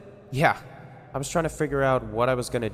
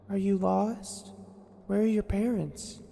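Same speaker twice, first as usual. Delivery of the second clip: gentle; 11 semitones higher, slower than usual. Acted. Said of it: "Amber called gently".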